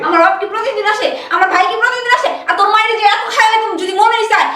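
loud, high angry yelling; furious shouting. Acted. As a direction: scream it at your husband. Wife shouting in quarrel. (স্বামীর ওপর রেগে চিৎকার)

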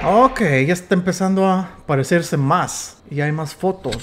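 normal talking voice

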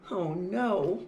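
ordinary delivery

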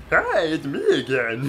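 high, slow, put-on greeting; silly character voice. Boss coming back again, silly high voice. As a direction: greet them as a goofy cartoon boss.

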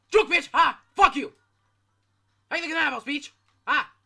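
loud gloating shout; triumphant taunt. Real, not acted. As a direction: crow it over the enemy. Escaping an enemy, taunting.

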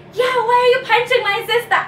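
put-on high, fast, loud kid shout; brother's outraged yell. Little brother yelling at the man who punched his sister. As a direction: defend your sister angrily.

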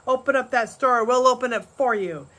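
a man in the crowd threatening Pa; shouted threat. A narrator's reading. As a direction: warn Pa they'll force it. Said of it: "came a shout".

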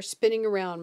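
ordinary delivery

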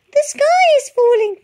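very high, loud panicked chick; frightened chick gasps out the news, panting. A narrator's reading. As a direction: blurt it out breathless like a frightened chick. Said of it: "panted Chicken Licken".

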